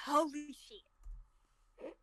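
attempted Australian accent. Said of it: "pull off an Australian accent".

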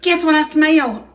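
loud, high and thrilled at the smell; bursting with delight over the cobbler; excited. Real, not acted. Opening the oven for viewers, loud and thrilled.

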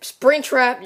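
ordinary talk, nothing marked

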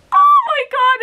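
high, loud squeal of delight; excited shriek. Retold or imitated. Vlogger relives finding her package, thrilled squeal. Opening door, discovers awaited package.

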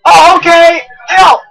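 loud, high-pitched cursing; worked-up yell. Real, not acted. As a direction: curse him out at the top. Yelling at companion mid-fight.

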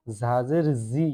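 জি buzzed like a cricket's chirp, slowly; buzzing pronunciation. Shown as a demonstration. শিক্ষক ঝিঝি পোকার মতো জি উচ্চারণ দেখাচ্ছেন. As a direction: make জি buzz like a cricket. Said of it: "ঝিঝি পোকার মতো একটা ডাক".

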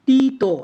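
তি তো as two stretched syllables, tee-to; wrong split pronunciation. Shown as a demonstration. Teacher showing what we don't say, split wrong pronunciation. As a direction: draw out তি, then add an open তো. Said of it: "এরকম আমরা উচ্চারণ করি না".

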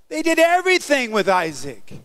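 normal talking voice

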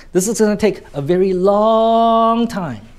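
long held very long and high; stress on long. Shown as a demonstration. Teacher repeating the demo, long hugely stretched. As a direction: draw long out high, then drop on time. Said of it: "you actually elongate that word".

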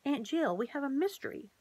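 high, thrilled announcement; excited cry. Acted. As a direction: burst out with the big news. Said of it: "cried Lana".

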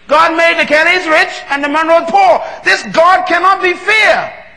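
rising to a high, loud preacher's shout; fiery pulpit preaching, shouting at the peak; preaching at full force. Acted. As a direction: build the sermon to a roaring climax.

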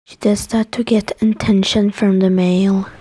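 normal talking voice

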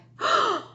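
sharp high gasp of alarm; startled gasp. Acted. Teacher voicing the waking farmer, with a high startled gasp. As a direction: gasp as the farmer wakes late. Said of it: "she said with a cry".

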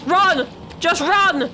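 high frantic shout to run; panic, yell them away; yelling while running away. Real, not acted. Monster attacks, fleeing.

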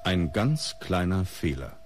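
ordinary talk

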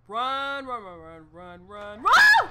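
frantic rising screams of run; panicked yelling. Real, not acted. Player fleeing the monster, screaming in panic. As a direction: yell run in terror as it chases.

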